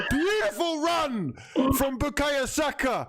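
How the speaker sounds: high, thrilled praise; excited commentary. Real, not acted. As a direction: still buzzing from the goal. Goal celebration commentary.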